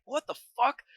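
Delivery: mock startled disbelief; retold bewilderment. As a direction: act out being startled, without volume. Retells being startled awake by banging.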